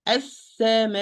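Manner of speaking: "As-sama" with a long final vowel closed on a hamza; pronunciation demo. Shown as a demonstration. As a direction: draw out the end of "As-sama", then stop it at the throat. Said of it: "How I'm going to pronounce the sama".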